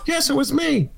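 a hint of a caller owning up; [name]. [name] admits ringing the doorbell, lightly voiced. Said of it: "Was that you ringing".

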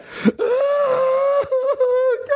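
high choked gasp, half laugh half sob; overwhelmed gasp. Retold or imitated. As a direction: gasp like you can't believe it. Overwhelmed by son's adoption request.

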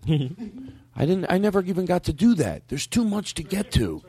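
ordinary talk, nothing marked

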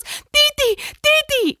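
put-on high, loud little toddler crying out. Mom imitating her toddler calling baby. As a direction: say it like a frightened toddler. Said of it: "She goes".

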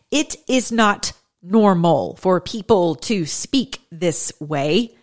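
slow, measured speech with pauses; slow deliberate cadence. Shown as a demonstration. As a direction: stretch the words out deliberately. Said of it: "when people talk like this".

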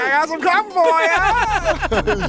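high cartoon voice; squeaky delivery voice; mock delivery guy. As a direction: sing it out like a goofy courier.

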